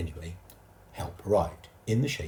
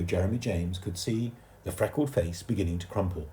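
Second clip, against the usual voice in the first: ordinary delivery, nothing marked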